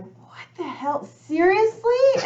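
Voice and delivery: high, drawn-out mock outrage building loud; exasperated retelling. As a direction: act out incredulous protest at God, stretching words. Retold exasperated complaint to God.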